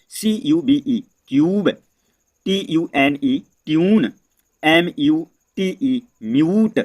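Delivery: slow, letter by letter, then क्यूब, ट्यून, म्यूट each with a clear यू; slow spelling demo. Shown as a demonstration. Teacher spelling out example words slowly for students. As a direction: spell each word slowly and land on its यू sound. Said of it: "words का pronunciation क्या निकलता है".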